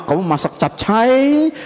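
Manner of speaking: ordinary delivery, nothing marked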